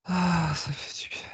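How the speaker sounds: breathy whisper of relief; relieved whisper. Real, not acted. Soulagement après la séance. (murmure soulagé)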